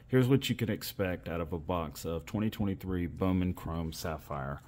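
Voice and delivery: ordinary delivery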